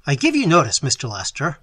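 loud warning called through a keyhole; loud cry at the keyhole. Acted. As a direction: shout the notice into the lock. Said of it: "he cried loudly at the keyhole".

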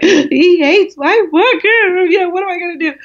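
hammed-up despair, pitched well up; mock despair. Author who has heard nothing back from the publisher, mock despair.

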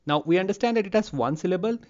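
usual speaking voice

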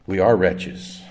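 normal talking voice

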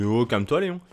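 loud teasing scold; half-joking loud calm down; exclaiming. Real, not acted. Scolds his own character.

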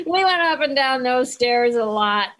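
talking through a real laugh. Real, not acted. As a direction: chuckle through the line. Laughing while recalling filming.

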